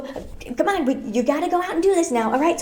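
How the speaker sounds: ordinary delivery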